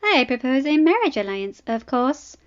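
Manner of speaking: amused proposal. Acted. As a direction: toss it off as if obvious. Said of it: "highly amused".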